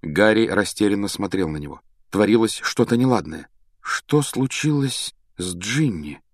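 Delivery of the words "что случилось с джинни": slow, wary, higher-pitched question. A narrator's reading. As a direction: draw the question out uneasily. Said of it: "произнёс он медленно". (медленно произнося)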